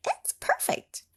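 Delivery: very high whispered voice; breathless high delight. Acted. Mabel finding the right mountain, high whisper. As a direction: gasp it out in tiny delight.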